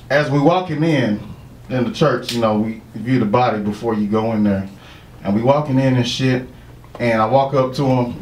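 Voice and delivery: ordinary talk, nothing marked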